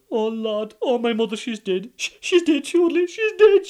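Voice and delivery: rising to a loud high wail; fake lament. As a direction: ham up the grief. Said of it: "he started crying and all a-groaning".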